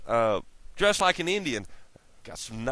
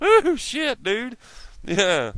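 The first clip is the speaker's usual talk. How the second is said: much higher and louder sudden ooh; a jolt of surprise, loud and high; surprised; 11 semitones higher, 4 dB louder, much slower than usual. Real, not acted. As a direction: blurt it out, caught off guard. Startled exclamation.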